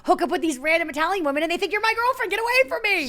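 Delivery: high, fast pleading rant; exasperated brother. Retold or imitated. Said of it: "he would be like".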